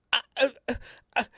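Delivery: high nervous uh-uh-uh; flustered stammer. Retold or imitated. Actor put on the spot stammering before improvising. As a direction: stall for time, panicking. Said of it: "And I went".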